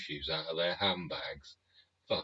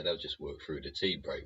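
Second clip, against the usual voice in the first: ordinary talk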